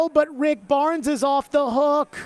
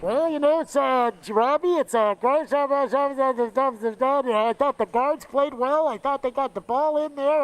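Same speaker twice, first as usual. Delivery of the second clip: sleepy Joe; 3 semitones higher than usual. Retold or imitated. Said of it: "sounding like".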